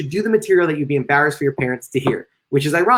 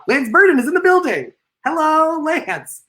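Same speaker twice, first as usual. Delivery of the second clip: high-pitched announcement and greeting; excited shout-out; 13 semitones higher, slower than usual. Real, not acted. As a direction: sing out a high, playful welcome to the guest.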